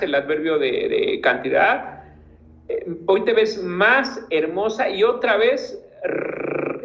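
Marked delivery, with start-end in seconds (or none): 3.1-4.9 "más" in "hoy te ves más hermosa" lifted and stretched; stress on MÁS. Shown as a demonstration. Teacher shows how más replaces exclamation marks, stressed. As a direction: lean hard on más. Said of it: "observen el más".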